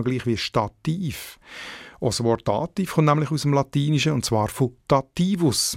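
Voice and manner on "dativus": Dativus stressed on -TI-; stress on penultimate syllable. Shown as a demonstration. Editor citing the Latin, Dativus stressed on -ti-. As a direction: put the weight on the middle of Dativus. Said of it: "Betonung auf der zweitletzten Silbe".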